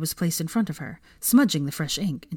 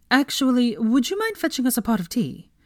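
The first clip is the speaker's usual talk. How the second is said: polite request; 7 semitones higher than usual. Acted. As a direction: ask it politely, a bit awkward. Harry asks the elf.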